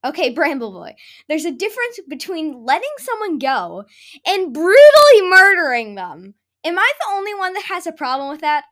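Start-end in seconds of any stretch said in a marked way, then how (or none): 1.3-8.7 loud outraged protest. Real, not acted. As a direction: shout it in disbelief. Outraged rant.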